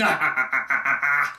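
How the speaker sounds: imitated theatrical villain cackle; villainous laugh. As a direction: cackle like a Joker-esque villain. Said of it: "He has this villainous charm".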